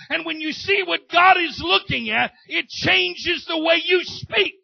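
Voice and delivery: loud, driving sermon voice that keeps building; preaching at full force. Acted. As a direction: preach it to the back row.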